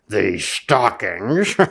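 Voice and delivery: slow low gloating with a chuckle; lightly voiced gloating Grinch; grinning Grinch. A narrator's reading. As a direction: gloat over the stockings. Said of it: "he grinned".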